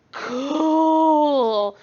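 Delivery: put-on high, loud drawn-out kid's cool; impressed kid. Son seeing the gallbladder photo, loud awed kid cool. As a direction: sound wowed like a little boy.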